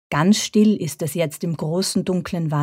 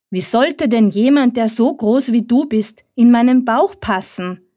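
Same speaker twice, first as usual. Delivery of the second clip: higher, louder call after him; 6 semitones higher, 4 dB louder than usual. A narrator's reading. Little fox calls after the running hare, louder. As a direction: shout it after the fleeing hare. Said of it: "ruft er ihm nach".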